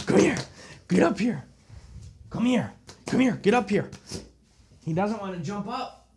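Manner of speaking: high sing-song pet coaxing; pleading with the dog to jump up, high and soft. Real, not acted. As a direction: coax the dog up onto the couch.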